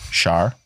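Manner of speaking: "Shower" squashed into one syllable, like "shar"; melted syllables. Shown as a demonstration. Irish host showing own accent, "Shower" melted to "shar". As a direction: run "Shower" together as "shar". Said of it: "melt all the syllables together".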